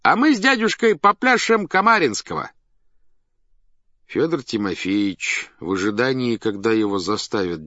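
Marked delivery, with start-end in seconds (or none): 0.0-2.5 high, loud ringmaster patter; showman announcing a dance. A narrator's reading. (задорный выкрик циркача)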